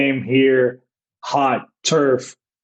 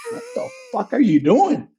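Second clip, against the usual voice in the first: high, cracking mock outrage; fake angry outburst; 14 semitones higher than usual. Manager pretending rage at umpire, high shouted fake fury. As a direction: play furious at the umpire for show. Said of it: "acting like I'm actually mad at him".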